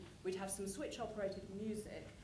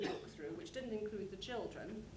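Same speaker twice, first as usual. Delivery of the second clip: ordinary delivery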